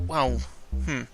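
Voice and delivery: ordinary talk, nothing marked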